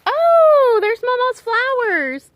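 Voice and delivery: high, slow, tender discovery; fond rush of recognition; delighted surprise. Real, not acted. Finds mother's flowers.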